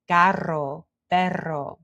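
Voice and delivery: "carro, perro" with trilled double R; "carro, perro" in Spanish, tongue trilling mid-word; rolled Spanish R. Shown as a demonstration. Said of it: "words that have the R in them".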